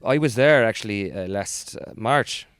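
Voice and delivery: lilting put-on Irish; Irish accent. Showing a favourite accent with a travel tale, Irish accent. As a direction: chat away in an Irish brogue.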